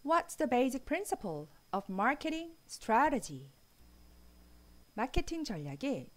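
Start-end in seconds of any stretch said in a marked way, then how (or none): none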